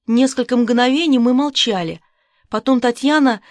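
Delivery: ordinary delivery, nothing marked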